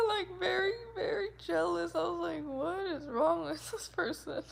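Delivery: high, giggling through the words; laughing. Real, not acted. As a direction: laugh it out in disbelief. Laughing at the jealous stares.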